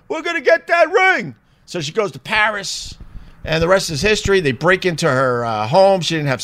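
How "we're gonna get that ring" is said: much higher, cartoonish scheming robber; robbers' line repeated as a high silly crook; greedy robbers. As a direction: squeal it like a giddy crook. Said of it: "these potential robbers were like".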